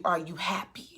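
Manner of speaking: whispered question, no voice at all. Retold or imitated. Ex whispering to Issa whether she's happy. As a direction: breathe it out like a secret. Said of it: "And he's just like".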